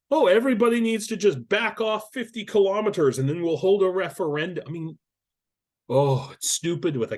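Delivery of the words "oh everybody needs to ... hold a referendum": higher, louder mock proposal; mocking quote. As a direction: parrot the plan with scorn, pitched up high. Mocks a proposed peace plan.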